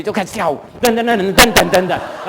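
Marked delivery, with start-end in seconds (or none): 0.8-1.9 hummed dance beat. Retold or imitated. Imitates the dance tune.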